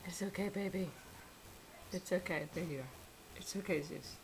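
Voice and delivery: soft, gentle reassurance; calm the dog down softly; soothing a scared dog. Real, not acted.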